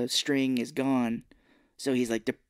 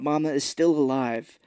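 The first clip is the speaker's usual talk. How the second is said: ordinary delivery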